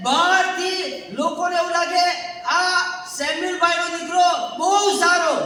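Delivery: loud, driving pulpit delivery; preaching at full force. Acted. Preacher to congregation, forceful preaching. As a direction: hammer each line home to the church.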